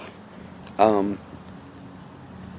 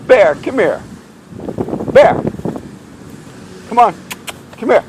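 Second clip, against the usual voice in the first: calling the dog; 11 semitones higher than usual. Real, not acted. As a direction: call out sharply for a dog that won't come.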